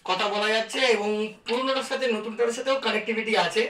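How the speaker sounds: normal talking voice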